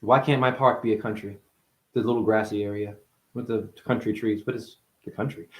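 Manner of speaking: normal talking voice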